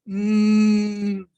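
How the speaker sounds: long loud hum with closed lips; teacher humming Mm for the class; exaggerated mm. Shown as a demonstration. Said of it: "Can you do a mm for me".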